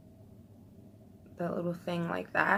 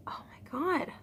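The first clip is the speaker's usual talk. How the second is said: whisper; 10 semitones higher, 6 dB quieter than usual. Real, not acted. Amazed at her own result.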